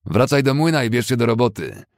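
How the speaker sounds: amused taunt. Acted. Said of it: "wyraźnie rozbawiony Fessenden".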